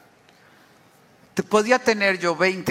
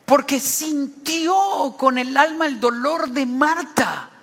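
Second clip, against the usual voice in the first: high, forceful sermon delivery; raised-pitch preacher at full stretch; impassioned preaching; 7 semitones higher than usual. Acted. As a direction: preach it with rising force, drawing out key verbs.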